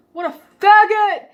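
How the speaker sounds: high, loud jeering shout; mock jeer from a classmate; loud taunt. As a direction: throw the slur out loud. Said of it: "said it loud enough so I could hear it".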